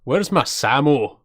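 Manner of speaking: slow line in a Scottish accent; put-on Scottish voice. Shown as a demonstration. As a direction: say it like a Scot, unhurried. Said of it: "I can do a Scottish accent".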